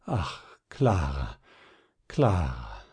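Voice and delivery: low, slow, broken sighing of a name; hopeless lament, voice sinking low; despairing sigh. A narrator's reading. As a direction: sigh it out as if dissolved in grief. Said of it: "seufzte wie aufgelöst in trostlosem Jammer".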